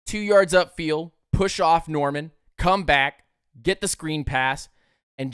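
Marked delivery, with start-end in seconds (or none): none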